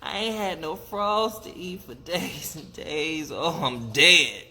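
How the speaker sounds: melodramatic dying lament; hammed-up death speech; play-acted dying. A narrator's reading. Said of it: "there he commenced to dying".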